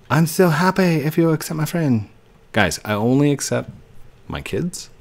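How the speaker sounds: ordinary delivery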